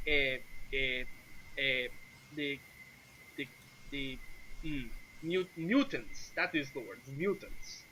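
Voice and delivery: halting, with long pauses and false starts; searching for word. Acted. Sergei hunts for a word in Common, haltingly. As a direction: grope for the word, then land on it. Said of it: "he's, like, searching for the word".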